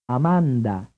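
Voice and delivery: Amanda with dental d and open vowels; Italian Amanda, every vowel "ah"; Italian pronunciation. Shown as a demonstration. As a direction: say Amanda as ah-MAHN-dah. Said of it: "consonant in question is dental".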